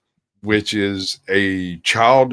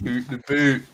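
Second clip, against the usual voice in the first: higher, softer Canadian "Oot in a boot" with rounded oo vowels; mock Canadian accent; 4 semitones higher, 3 dB quieter than usual. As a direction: insist on it in a joking Canadian accent. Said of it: "Canadians tried to play soccer".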